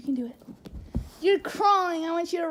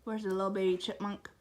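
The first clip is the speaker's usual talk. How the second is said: ordinary talk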